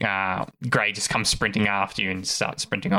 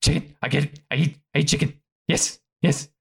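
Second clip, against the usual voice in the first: put-on higher-pitched young creature voice; small, raised creature voice; eager pup; 5 semitones higher than usual. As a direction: voice an excited pup after a chicken. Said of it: "you hear Grey go".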